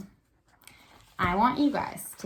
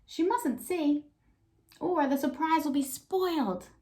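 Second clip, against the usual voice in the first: Peter's worried thought; 8 semitones higher, slower than usual. Acted.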